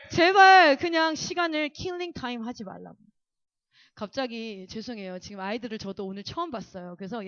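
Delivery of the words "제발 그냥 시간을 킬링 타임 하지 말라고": 크게 시작하는 답답한 호소; 제발 들으라고 힘주어 당부; 애타는 당부. Acted. 학생들에게 애타게 당부. (답답함 섞인 훈계)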